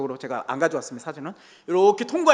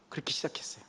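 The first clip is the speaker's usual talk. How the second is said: whisper; 3 semitones lower, 15 dB quieter, faster than usual. Real, not acted. Closing the humble-beginnings story.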